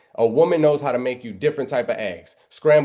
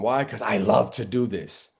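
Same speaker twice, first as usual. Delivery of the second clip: normal talking voice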